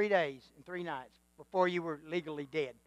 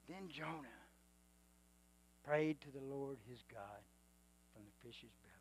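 much softer, lower repeat; soft reverent reread of the verse; hushed reading; 5 semitones lower, 17 dB quieter than usual. Shown as a demonstration. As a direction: drop it to a near hush the second time. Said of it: "This next part is big".

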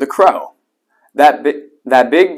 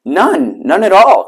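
higher, emphatic denial; protesting cry; 7 semitones higher, 5 dB louder, slower than usual. A narrator's reading. The accused iguana answers King Lion, crying out in protest. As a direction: cry out the denial, pitch leaping up. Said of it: "cried the iguana".

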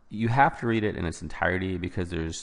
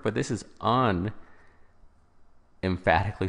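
slow, split "un-emphatically" with the "un" punched; stress on un; slower than usual. Shown as a demonstration. Tutor contrasting with emphatically for students, stressing "un". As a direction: linger on "un" in "un-emphatically" to mark the negation. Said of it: "so without emphasis".

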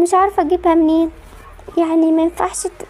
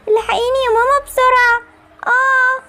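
higher, panicked plea with rising urgency; frantic cry for help; 7 semitones higher than usual. Acted. Sick daughter begs her mother to hurry, panicked. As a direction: push the fear up as if about to collapse.

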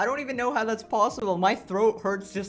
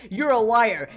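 imitating the squabble, louder and higher; cute mock quarrel; mock shout; 3 semitones higher, 7 dB louder than usual. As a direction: yell the accusation playfully. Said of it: "cutest thing ever".

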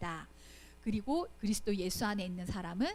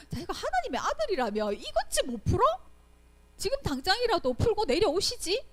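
a raised, taunting sneer at the man on the cross; mocking jeer; 9 semitones higher, 5 dB louder than usual. Crowd scoffing at Jesus on the cross, raised mocking taunt. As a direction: taunt him like a scoffing bystander. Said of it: "이렇게 사람들이 비웃었잖아요".

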